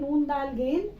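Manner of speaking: ordinary talk, nothing marked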